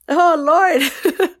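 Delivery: high, loud "Oh, Lord" fighting back tears; emotional outburst. Real, not acted. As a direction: burst out overwhelmed, close to tears. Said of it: "I honestly was about to start crying".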